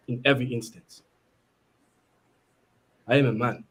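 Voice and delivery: low, measured South African accent; Shaka Zulu. Shown as a demonstration. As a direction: play a warrior in a deep South African accent, pausing between phrases. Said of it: "I just did South Africa, Shaka Zulu".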